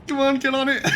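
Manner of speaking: high loud come on; frantic urging. Real, not acted. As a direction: urge them on, frantic. Urging crew at deck gun.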